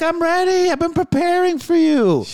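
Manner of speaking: imitated smug threat; cocky taunting elder. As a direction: gloat that you are ready for him. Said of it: "He's like".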